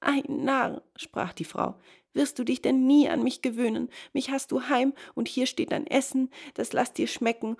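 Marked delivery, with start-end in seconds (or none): 0.0-0.8 high, chiding exclamation; higher-pitched exasperated wife; scolding wife. A narrator's reading. Wife scolds the man-eater. 2.1-7.5 high, brisk nagging wife; higher-pitched domestic scolding. A narrator's reading. Wife scolds and placates man-eater.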